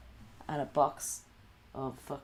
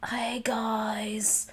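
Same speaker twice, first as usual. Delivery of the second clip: high, louder, breathy fake cheer; mock streamer greeting; 8 semitones higher, 4 dB louder than usual. Mocking a bright livestream greeting to guys, high and airy. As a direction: put on a cutesy stream hello. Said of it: "go on and be like".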